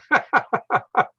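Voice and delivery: high, rolling laugh; amused laugh. Real, not acted. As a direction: laugh it off after greeting everyone. Laughs greeting viewers after mishap.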